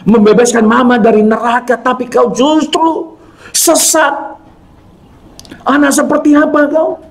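high-pitched imitation of a distraught mother; acted tearful reproach. Mother pleading with her lost eldest son, acted tearful reproach. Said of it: "Sambil mama saya nangis".

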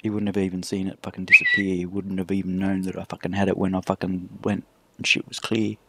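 normal talking voice

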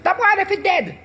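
high mock jeering voice; a high hammed-up Jamaican troll jeer; mocking online critics. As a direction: sneer like mocking commenters. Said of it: "They were like".

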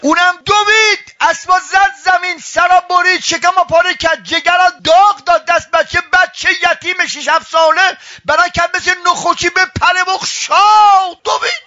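high, forceful storytelling at full pitch; impassioned preaching. A narrator's reading. Preacher tells of servant rushing for orphan, full-force narration.

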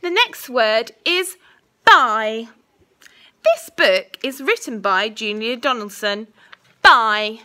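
slow, clear dictation voice; school dictation. Acted. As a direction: say each word carefully for spelling. Teacher dictating a spelling test.

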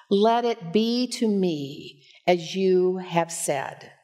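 ordinary talk

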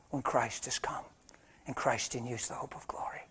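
soft, unvoiced whisper. Pastor whispering hope to the congregation. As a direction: breathe it like a secret.

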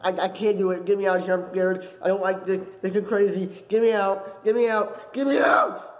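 frantic pleading, climbing in pitch; acted meltdown, rising to a yelp; panicked breakdown. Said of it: "I have a mental breakdown".

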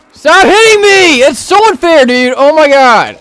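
high, loud protest under attack; outraged panicked yell. Real, not acted. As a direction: scream at the unfairness of it. Being hit by zombies.

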